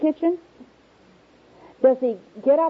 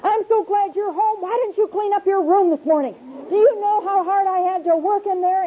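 higher, exasperated nagging; frazzled mom scolding; 7 semitones higher than usual. Pretend. Mother scolding child about his messy room, exasperated. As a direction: nag the kid, worn out and fed up. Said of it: "what you're saying to them is".

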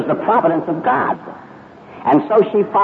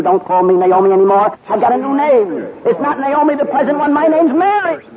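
bitter; 10 semitones higher than usual. Acted. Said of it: "she said to them bitterly".

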